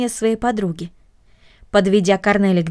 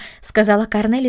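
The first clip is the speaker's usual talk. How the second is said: ordinary delivery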